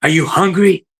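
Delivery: a kind question barked out angrily; angry. Retold or imitated.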